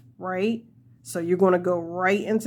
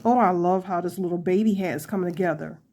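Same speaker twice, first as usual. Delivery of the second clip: ordinary talk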